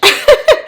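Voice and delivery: high loud burst of laughter; real laugh. Real, not acted. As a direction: laugh at your own joke. Laughing at coach.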